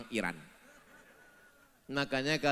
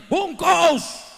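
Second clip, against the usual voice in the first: high, loud, sharp order; shouted command; 14 dB louder than usual. Acted. Preacher telling the flock to shout at arrogant officials. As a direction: snap the word at them.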